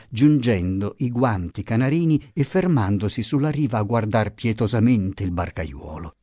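plain reading voice

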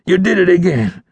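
high, strained, outraged accusation; pained outrage. A narrator's reading. Outlaw shot a second time by the ranger, pained and outraged. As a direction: accuse through the pain. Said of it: "he said, pained and outraged".

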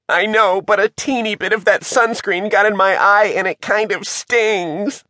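high-pitched whimpering excuse; whiny complaint. A narrator's reading. Shivers has sunscreen in the eye, whining. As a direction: whine about a small hurt.

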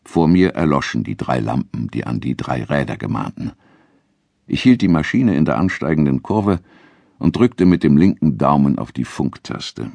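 ordinary delivery

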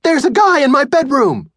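put-on high, loud panicked boy; boy panicking to his mom. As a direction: blurt it out in a panic. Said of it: "I said, panicked".

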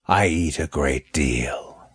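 slow, drawn-out snake voice; unhurried, stretched-out words. A narrator's reading. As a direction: say it slowly, as a deliberate snake. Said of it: "said Malachi slowly".

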